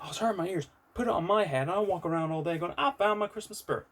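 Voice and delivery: high, loud mock-American quote; American accent. Retelling a manager's complaint to viewers, in a mock American accent. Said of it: "went so American with that".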